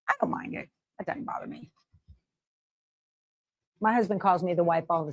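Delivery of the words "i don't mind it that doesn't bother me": whispered aside. Real, not acted. Aside reply to viewer question.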